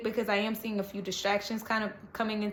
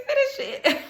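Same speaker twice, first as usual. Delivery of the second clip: "Finish it." much higher, laughing; laughing command; 3 dB louder than usual. Retold or imitated. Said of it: "That's all I'm hearing is".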